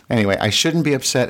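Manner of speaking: ordinary delivery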